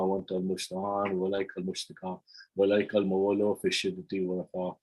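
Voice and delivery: fast, soft Arabic prayer recitation; supplication recital. Acted. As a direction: murmur the dua quickly and devoutly.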